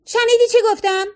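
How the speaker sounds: high-pitched, narrated screech; shrieking demand. A narrator's reading. Fiona demanding whether she was heard, shrieking. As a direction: snap it out high and sharp. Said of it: "فیونا دوباره جیغ کشید".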